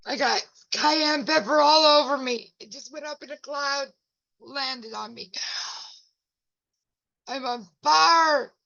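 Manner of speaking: loud, high and flustered, words dragged out on fire; flustered pepper panic. Real, not acted. Covered in pepper, telling viewers, loud high panic. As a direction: blurt it out while the pepper burns. Pepper cloud burning her.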